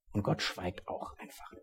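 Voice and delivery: a slow, barely voiced hush. A narrator's reading. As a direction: breathe it out slowly. God's silence at the cross.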